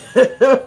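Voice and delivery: big real laugh; loud laugh. Real, not acted. Streamer cracks up at her own joke, laughing loudly. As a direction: burst out in a loud high laugh.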